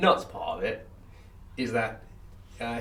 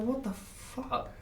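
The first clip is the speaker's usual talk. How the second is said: mock shock; 13 semitones higher, 8 dB quieter, much slower than usual. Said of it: "we were like".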